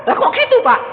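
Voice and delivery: loud, very high mock outcry; indignant protest. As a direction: protest incredulously to the pastor. Acted congregant protesting to pastor. (protes heboh bernada tinggi)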